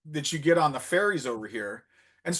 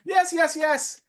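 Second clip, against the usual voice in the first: imitating happy women; 12 semitones higher, 3 dB louder, slower than usual. Imitating delighted women agreeing.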